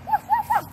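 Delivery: loud high puppy barks; dog barking. Pretend. Child singing about her dog, loud high puppy barks. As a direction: bark out loud like a little dog.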